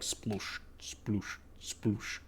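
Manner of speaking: soft splash sounds. Retold or imitated. Mud bath splash noises.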